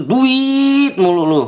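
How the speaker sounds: high, slow nagging mock voice; mocking whiny kid. Streamer mocking a greedy kid in chat, high whiny taunt. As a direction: drag it out like a whiny brat.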